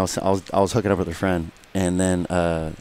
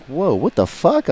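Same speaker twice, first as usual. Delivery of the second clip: slow stunned surprise; 9 semitones higher, slower than usual. Retold or imitated. Retold seeing the dudes arrive.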